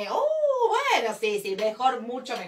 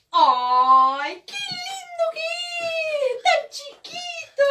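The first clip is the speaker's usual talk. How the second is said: adoring; 12 semitones higher, much slower than usual. Acted. Clown cooing over the tiny spaceman, adoring. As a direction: coo over something tiny and cute.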